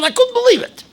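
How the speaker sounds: can't believe his luck; amazed joy. Retold or imitated. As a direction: soar up in wonder. The man's overwhelming joy.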